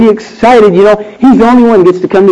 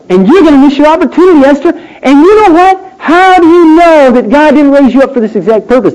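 raised, urgent preaching voice; 9 semitones higher than usual. Acted. Preacher voicing Mordecai's challenge, urgent. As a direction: press the charge on Esther hard.

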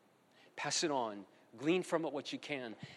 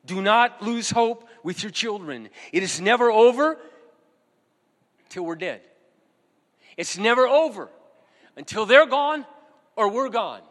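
loud, higher preaching cry; fervent exhortation; 6 semitones higher, 12 dB louder than usual. Real, not acted. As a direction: call out to the fathers with full force. Exhorting fathers not to lose hope.

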